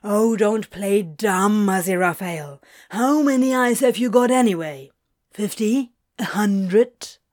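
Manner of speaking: exasperated. A narrator's reading. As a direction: challenge him loudly, out of patience. Crowley confronting the angel.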